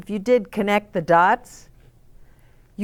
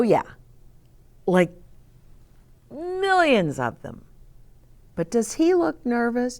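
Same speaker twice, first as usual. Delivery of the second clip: ordinary delivery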